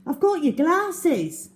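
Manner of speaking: calm her, it's only you; boy reassuring a scared girl; Algy reassuring. A narrator's reading. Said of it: "said Algy".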